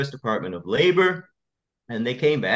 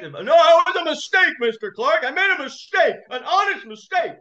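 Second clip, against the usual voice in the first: much higher, shrill-edged insistence as the judge; insistent judge; 4 dB louder than usual. Retold or imitated. Judge insisting to Mister Clark it was a mistake, high and indignant. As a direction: dig in like a judge who won't admit fault. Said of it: "he was shouting at me".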